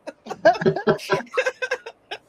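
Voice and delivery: high burst of real laughter. Real, not acted. Laughing at her own pie pride.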